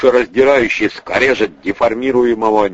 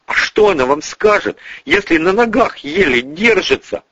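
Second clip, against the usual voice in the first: higher-pitched indignant blurt; blurted retort; 9 semitones higher than usual. Acted. Tamara cuts in to defend a woman, blurting it out. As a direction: fire it out as an objection. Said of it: "выпалила Тамара".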